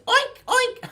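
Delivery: high-pitched playful pig oinks. Pretend. Host sings about pig on farm, oinking high. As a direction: squeal it like a pig. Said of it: "he makes a sound like this".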